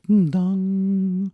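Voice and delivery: high hummed mock dong; imitating tuning fork. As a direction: hum the fork note very high. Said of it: "be like".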